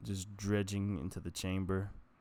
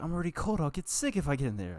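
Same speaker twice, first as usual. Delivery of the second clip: higher whiny complaining; whining excuse; 8 semitones higher, faster than usual. Retold or imitated. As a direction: whine like a kid avoiding the cold. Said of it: "I'd be whining".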